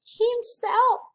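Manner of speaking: put-on a girl wailing that it was an accident. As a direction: plead tearfully and loudly. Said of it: "Sheila cried louder".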